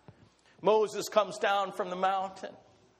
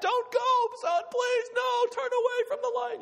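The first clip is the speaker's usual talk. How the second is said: very high pleading cry to a son; desperate father. Acted. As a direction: plead with him, voice cracking up high.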